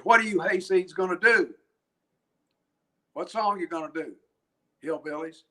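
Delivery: voz algo más aguda de tipo burlón; lightly voiced guy calling down to hillbillies; heckling tower guy. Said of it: "the guy up in the tower named Mike from New Jersey".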